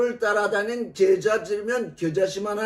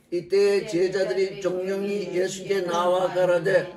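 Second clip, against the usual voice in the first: ordinary delivery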